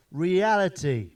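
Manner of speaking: drawn-out, weighty punchline; slow punchline. Acted. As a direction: let the word land slowly and loud.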